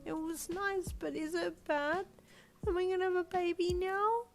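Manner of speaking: put-on high, anxious little-girl wail; upset, rising into a panicked question; worried child. A narrator's reading. Worried child wailing to dad.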